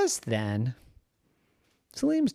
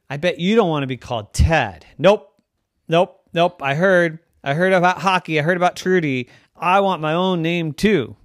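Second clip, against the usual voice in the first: high, loud eager little-creature voice; the hamster piles on; 7 semitones higher, 10 dB louder than usual. Acted. Hamster Ted tells Salim he wants a name too, eager hamster.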